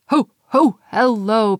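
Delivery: loud hearty ho-ho greeting; jolly Santa. A narrator's reading. Santa greeting Pixie with a jolly laugh. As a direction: boom a jolly hello.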